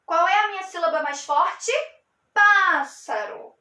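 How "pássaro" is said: "Pássaro" drawn out, leaning hard on "Pá"; slow teaching "Pássaro" with punched first syllable; stressed first syllable. Shown as a demonstration. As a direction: hit the "Pá" in "Pássaro", then fall away. Repeats word stressing strongest syllable.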